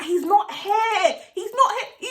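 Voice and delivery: high, drawn-out insistent shout; insistent calling out. Retold or imitated. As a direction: call it out to them, stretching the words. Calling out to the intruders.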